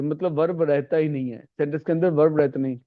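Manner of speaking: ordinary talk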